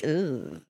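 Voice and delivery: quiet grossed-out groan; a soft, disgusted grunt; disgusted ugh. Retold or imitated.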